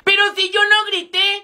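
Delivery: normal talking voice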